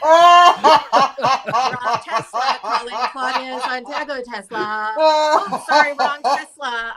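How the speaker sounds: slow, loud robotic car voice, laughing; car voice assistant. Pretend. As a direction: play a confused car assistant.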